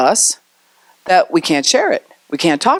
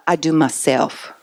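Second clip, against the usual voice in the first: put-on quiet, slow little-kid voice; a lightly voiced three-year-old insisting; soft and slow, like a small child; toddler voice; 2 semitones lower, 5 dB quieter, slower than usual. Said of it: "they're coming out of the mind stage".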